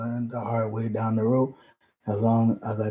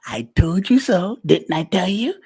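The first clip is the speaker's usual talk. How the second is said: louder, higher, slow smug parent; mocking I-told-you-so; 7 semitones higher, 9 dB louder than usual. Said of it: "We hate that person".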